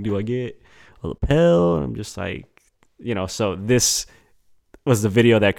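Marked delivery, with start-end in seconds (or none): 0.0-1.8 officiant voice. Retold or imitated.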